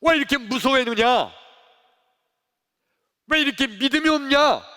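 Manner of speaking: high, very loud rebuke; scolding. Acted. Jesus to his frightened disciples, scolding loudly. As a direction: tell them off at full voice. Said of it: "야단치신 거예요".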